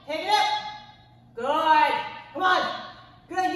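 energetic coach calling cues; counting workout reps. Acted. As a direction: pump up the class mid-set.

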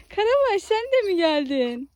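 high, loud doggy talk; pet voice. Real, not acted. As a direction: greet a dog with delight. Greeting the dog.

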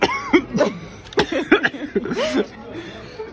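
high, helpless laughing fit; high-pitched real laughter; burst of laughter. Real, not acted. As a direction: crack up loudly.

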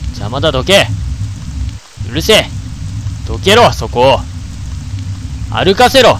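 loud, rough yelling at enemies; angry shouts. Real, not acted. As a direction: shove them aside with the voice. Fighting ghosts in game. (敵に怒鳴り散らす)

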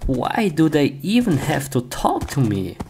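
usual speaking voice

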